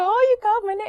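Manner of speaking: high, loud, gushing delight; mock thrilled mother. Parent hearing baby say mama, acted high-pitched excitement. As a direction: squeal it as a mother freaking out with joy.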